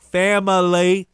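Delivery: drawn-out mock Southern accent; fake Southern accent. Radio host mocking a coach's Southern accent, exaggerated Southern drawl. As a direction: stretch it in a put-on Southern accent. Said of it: "trying to defend his Southern accent".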